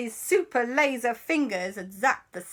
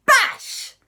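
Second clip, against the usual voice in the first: very high, loud smash; bash impact; 8 dB louder than usual. Acted. Papa answers the threat with a bash, loud shout. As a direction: fire it off as a sudden blow. Imitates a blow.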